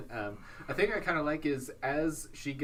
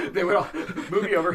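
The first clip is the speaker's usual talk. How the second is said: laughing; 6 semitones higher, 8 dB louder, faster than usual. Real, not acted. Laughing at the joke.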